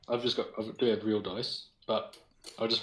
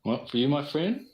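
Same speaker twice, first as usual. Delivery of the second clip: friendly merchant voice; 5 semitones higher, 5 dB louder than usual. Acted. Pie seller greets the guard.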